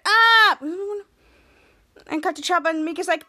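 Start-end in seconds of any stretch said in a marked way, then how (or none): 0.0-0.6 short high loud yelp; a cartoon yelp while falling; mock fall scream. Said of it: "Wasty said".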